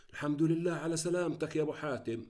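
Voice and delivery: plain reading voice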